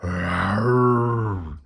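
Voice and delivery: a loud monster rawr; roar. A narrator's reading. Boris roaring as he chases Morris, beastly roar. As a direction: growl it like a big beast. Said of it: "Boris roared".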